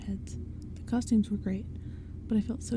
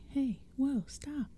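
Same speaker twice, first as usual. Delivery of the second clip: higher-pitched call to halt; demanding; 4 semitones higher, much slower than usual. A narrator's reading. Girl calling on the pumpkinheads to halt, demanding. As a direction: demand that they halt at once. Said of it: "Tabby demanded".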